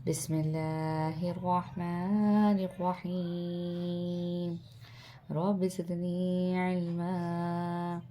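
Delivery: slow, melodic Quranic recitation. Acted. Teacher opening the lesson with a recited prayer. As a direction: chant each word long and measured.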